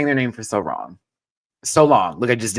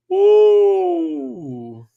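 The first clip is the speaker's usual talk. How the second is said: high, loud drawn-out "ooh"; long hoot; 8 dB louder than usual. Real, not acted. Streamer reacting to a wig, long high hoot. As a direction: falsetto whoop that drops away.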